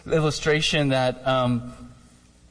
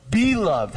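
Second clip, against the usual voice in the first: BE pushed high, then falling on loved; emphatic, high-pitched "be"; stress on BE; 6 semitones higher than usual. Shown as a demonstration. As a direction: punch "be" in "be loved" as a correction. Corrects "love" to "be loved.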